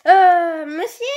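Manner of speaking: ordinary talk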